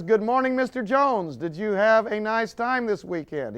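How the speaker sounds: high, bright-toned cheerful greeting; secretary greeting the boss, sugary high; cheery secretary. Retold or imitated. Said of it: "greets you cheerily".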